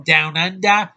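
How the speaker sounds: mock Australian accent. Reviewer mocking an actress's origins, mock Australian accent. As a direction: exaggerate a thick Australian accent. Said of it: "her accent is so thick".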